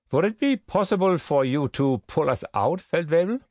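high-pitched, courteous officer; officer's rising, formal request; SS captain, requesting. A narrator's reading. Narrator voices the SS captain.

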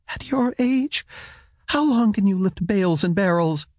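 mock his plan, bitterly; scornful wife. A narrator's reading.

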